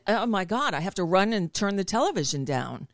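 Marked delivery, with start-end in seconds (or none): none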